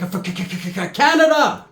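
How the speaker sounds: stuck stutter bursting into a high finish; stammer, then blurt the last word high. Retold or imitated. Primo stammers, blurts cover story.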